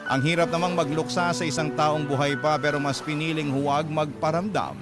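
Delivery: normal talking voice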